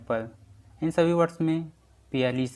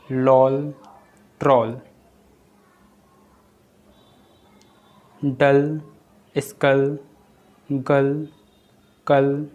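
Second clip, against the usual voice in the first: each word louder and set apart by pauses; loud word-list reading; 8 dB louder, much slower than usual. Shown as a demonstration. Teacher to students, calling out the example words. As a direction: call out every example word clearly for the class.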